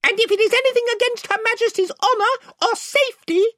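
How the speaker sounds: very high little warrior voice; Reepicheep. A narrator's reading. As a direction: pipe up in stern defence of the queen. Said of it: "added Reepicheep".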